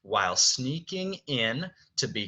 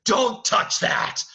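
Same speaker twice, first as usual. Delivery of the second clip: high, loud, slow scream; acted yell; 12 semitones higher, 8 dB louder, slower than usual. Beast screams at Belle reaching for the rose, loud yell. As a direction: yell each word out as the enraged Beast. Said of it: "screams at her".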